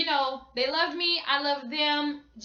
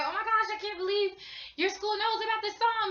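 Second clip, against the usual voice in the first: high, amazed gushing; excited; 6 semitones higher than usual. Retold or imitated. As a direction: gasp it out like a starstruck fan. Little Mix amazed at school.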